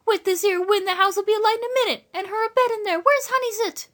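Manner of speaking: high-voiced, fretting country woman; worried country woman. A narrator's reading. Woman fearing for Lily in the fire, high fretting. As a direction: fret high up in a rustic woman's voice. Woman fears for her sister.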